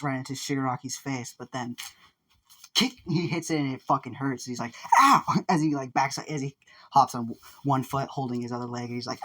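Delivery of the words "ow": acted yelp of pain. Fighter whose kick hurt his own foot, yelping. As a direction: cry out as the kick hurts.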